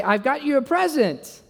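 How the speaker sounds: high-pitched, eager gift announcement; acted eagerness. Acting eager husband with gift.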